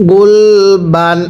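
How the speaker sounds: গুলবান stretched very slowly, ending on a dental n; teacher modelling গুলবান slowly for students; slow drawn-out reading. Shown as a demonstration. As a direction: read গুলবান syllable by syllable, slowly, with a clear final n. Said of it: "একসাথে আওয়াজ দিয়ে পড়তে হবে".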